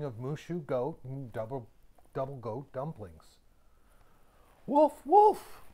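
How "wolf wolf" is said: high, loud shout for help; yelling boy. A narrator's reading. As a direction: call out wolf at full voice. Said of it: "the boy yelled out again".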